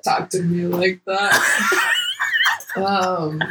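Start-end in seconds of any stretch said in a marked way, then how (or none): 0.0-1.9 slow words said through laughter; laughing through it. Retold or imitated. Cohost retelling her reaction to a new client, laughing. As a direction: giggle the line out slowly. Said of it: "I was like".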